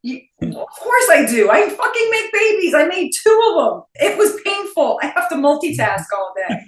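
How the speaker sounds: loud, high-pitched comic rant; indignant outburst. Acted. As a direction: fire back in hammed-up outrage. Indignant retort to the imagined claim.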